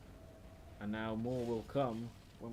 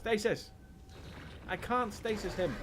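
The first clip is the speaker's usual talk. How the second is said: frustrated outburst; 8 semitones higher, slower than usual. Real, not acted. Gamer frustrated, ability failing.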